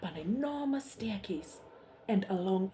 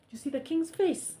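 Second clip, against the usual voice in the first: usual speaking voice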